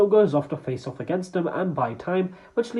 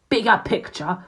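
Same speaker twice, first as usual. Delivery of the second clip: raised, scornful outburst at an underling; angry mock scream; 5 semitones higher, 3 dB louder than usual. A narrator's reading. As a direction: throw it back higher, in angry disbelief. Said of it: "with a scowl and screams".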